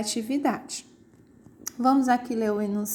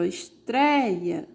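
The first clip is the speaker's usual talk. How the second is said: estreia said slowly, leaning on the E of "treia"; slow stressed demo; much slower than usual. Shown as a demonstration. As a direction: draw out estreia and hit the open E. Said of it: "o E tem uma tonicidade maior". (estreia dita devagar, com o E bem forte)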